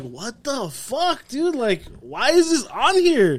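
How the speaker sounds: high, drawn-out disbelief; acted bafflement. Retelling his shock at an unwanted ad, acted bafflement. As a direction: stretch it out, pitched high and incredulous.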